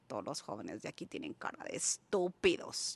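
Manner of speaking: low, muttered disdain; retold scorn. Newcomer judging the smiling youths, low scornful grumble. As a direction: grumble it far down in the voice. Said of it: "yo decía así".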